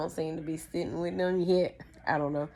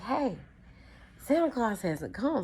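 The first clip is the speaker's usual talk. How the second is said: higher, lightly voiced little kid; child's voice; 6 semitones higher than usual. Child alerting older brother that Santa is missing, childlike. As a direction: pipe up as a young child.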